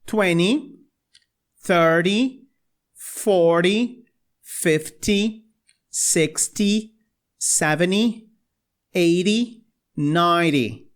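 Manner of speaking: tens spaced out for students; repeat-after-me drill. Shown as a demonstration. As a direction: count tens slowly for repetition. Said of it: "Então repitam comigo". (repitam comigo, devagar)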